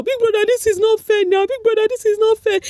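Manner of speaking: high, loud mock wail; wailing Mensah. Mensah wailing at his brother through the glass, high and loud. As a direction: plead like a sobbing last-born.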